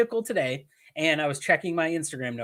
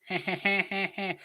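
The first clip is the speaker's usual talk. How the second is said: a soft mock giggle, higher than usual; imitated laugh; 5 semitones higher, 5 dB quieter than usual. Host imitating wrestler Gigi's laugh on the phone, as a soft mock giggle. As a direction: lightly copy a friend's little chuckle. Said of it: "or however he laughs".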